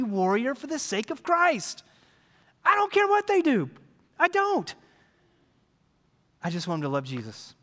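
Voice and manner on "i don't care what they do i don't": high, emphatic exclamation. Acted. Pastor insisting to the church, high and emphatic. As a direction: fling it out high.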